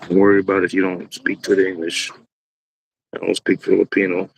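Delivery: normal talking voice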